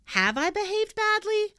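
high, loud shocked question. A narrator's reading. Pippi to the teacher, shocked to hear she behaved badly. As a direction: gasp it out in shock. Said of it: "asked Pippi, shocked".

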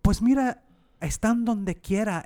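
high, put-upon bakery lady in Spanish; high shopkeeper voice. Retold or imitated. Retelling the bakery lady's reply to a customer, high and put-upon. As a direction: play the lady pointing the obvious out. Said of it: "she goes".

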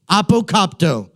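apokopto said clearly as ah-poh-KOP-toh; Greek word apokopto. Shown as a demonstration. Preacher teaching the congregation a Greek word, careful pronunciation. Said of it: "Everybody say apokopto".

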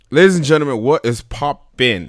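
loud, high hype welcome; hype host greeting. Acted. Show host greeting crowd.

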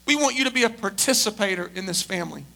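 usual speaking voice

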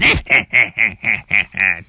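low villain's chuckle; low evil cackle; evil laugh. Shown as a demonstration. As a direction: laugh like a rustler. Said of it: "laughing evilly like this".

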